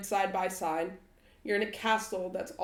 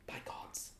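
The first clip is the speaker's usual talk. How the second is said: hushed, whispered oath; 15 dB quieter than usual. Acted. Trying out Strahd's line, whispered. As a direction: say it under your breath. Repeats the oath under breath.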